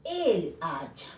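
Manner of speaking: el hacha said slowly, carefully spaced; slow model phrase. Shown as a demonstration. Teacher to students, modelling the correct article slowly. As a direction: lay out el hacha slowly as the right form. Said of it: "Voy a decir".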